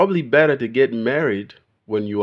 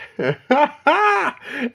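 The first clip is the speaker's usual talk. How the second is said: shrill laugh in a very high pitch; high squealing laugh; 6 dB louder than usual. Real, not acted.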